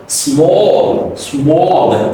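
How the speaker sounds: "small" with a long rounded aw and dark L; stretched sound. Shown as a demonstration. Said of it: "you stretch this out".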